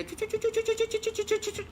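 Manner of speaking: very high squeaky running sound; scurrying noises. Acted. Mouse running to the horse, very high scurrying patter. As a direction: patter it like tiny running feet. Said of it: "sambil ngos-ngosan".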